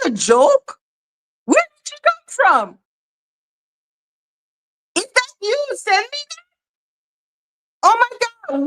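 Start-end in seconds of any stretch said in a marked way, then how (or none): 1.5-6.4 very high, thin shocked questions; squeaky disbelief. Retold or imitated. Shocked at Steph in chat. 7.8-8.6 shocked gasp. Real, not acted. Shocked reaction.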